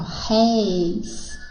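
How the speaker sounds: "réis" very slow, with an open e; "réis", open-vowel demonstration; open diphthong. Shown as a demonstration. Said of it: "aberto, tá vendo?".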